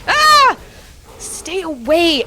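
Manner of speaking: high loud shriek, then slow plea; panicked high cry; scared yelp. Real, not acted. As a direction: fend off the monster. Enemy closing in.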